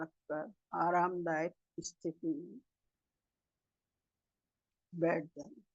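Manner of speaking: soft, unhurried meditation guidance with long pauses; guided meditation. Real, not acted. Meditation leader guiding masters to sit and breathe, calm guided meditation. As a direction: settle the group gently into stillness, phrase by phrase.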